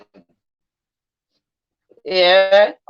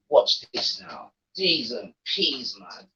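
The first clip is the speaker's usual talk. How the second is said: sly whisper; 10 semitones lower, 9 dB quieter, slower than usual. Real, not acted. Sly aside to chat.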